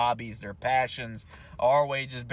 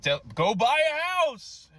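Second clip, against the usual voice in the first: much higher, slow, put-on sneering voice; mocking quote; 12 semitones higher, 5 dB louder than usual. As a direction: parody the smug advice, drawn out. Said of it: "They tell us".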